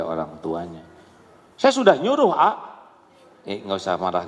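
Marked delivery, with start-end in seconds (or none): 1.5-2.5 high, louder indignant insistence; defensive protest. Retold or imitated. Parent protesting to the preacher, indignant. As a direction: snap back in self-defence.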